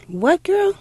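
snapped out of her thoughts; startled mother. Retold or imitated. Mother pulled from her prayer by her daughter, startled.

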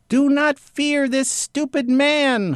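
very high, slow, floating voice; eerie duck voice scolding the women; sailed thrown voice. Acted. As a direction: sail it out of the duck's neck. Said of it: "I sailed the voice".

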